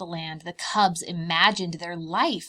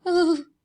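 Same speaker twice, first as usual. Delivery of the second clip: scared ooh; 9 semitones higher, 9 dB louder than usual. Acted. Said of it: "The cubs shuddered".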